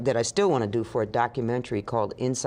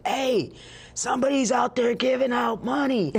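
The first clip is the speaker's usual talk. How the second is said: mock shout; 11 semitones higher than usual. A man calling to everyone inside, shouting. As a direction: yell it across the room.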